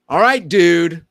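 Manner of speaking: loud, triumphant report; proud announcement. Retold or imitated. Back to the coach after two weeks, loudly proud. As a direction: call it out like a win.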